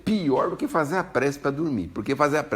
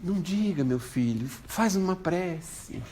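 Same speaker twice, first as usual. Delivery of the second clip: calm mother; 6 semitones higher, 4 dB quieter, slower than usual. Retold or imitated. Mother answers calmly on phone.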